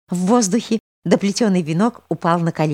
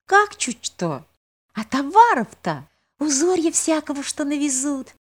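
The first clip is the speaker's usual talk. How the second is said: put-on excited girl; 10 semitones higher than usual. Girl keeps listing wonders.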